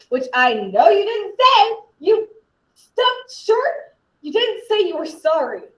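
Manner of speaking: high, heated rebuke; indignant accusation. Acted. Student accusing the yeller of never apologising, indignant. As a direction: build to an exasperated accusation.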